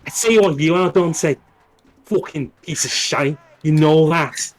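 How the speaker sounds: put-on Scouse hard man having a go; mock-aggressive Scouse accent; Scouse Virgil. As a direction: insult your rival in thick Scouse. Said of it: "Stephen Graham to play, uh, Virgil".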